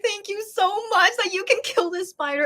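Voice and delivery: high-pitched retold gratitude; gushing thanks. Guest thanking cleaning lady for killing spider, gushing retold thanks. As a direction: pitch it way up in relief.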